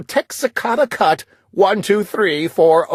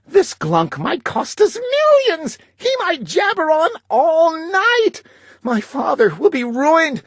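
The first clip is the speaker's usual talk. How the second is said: rising panic; 10 semitones higher than usual. A narrator's reading. Narrator panics about ruin.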